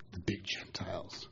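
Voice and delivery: hushed aside whisper. Real, not acted. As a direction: drop to a whisper. Aside about the Gentiles.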